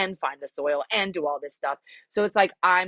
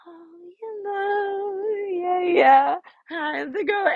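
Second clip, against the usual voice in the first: mock girly voice; 13 semitones higher, much slower than usual. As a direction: stretch it out like a coy pin-up. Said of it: "I've gotta be like".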